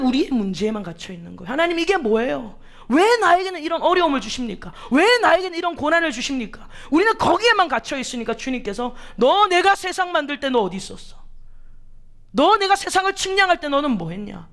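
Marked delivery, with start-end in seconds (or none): 1.5-6.7 raised, pleading complaint to God, higher and louder; imitating a complaint. Believer complaining to God about hardships, raised plea. 9.2-14.5 raised, higher rebuking voice of God. Acted. God answering complainers with a raised rebuke. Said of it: "라고 우리에게 오히려 반문을 하시는".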